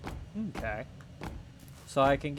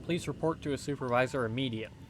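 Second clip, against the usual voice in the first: ordinary delivery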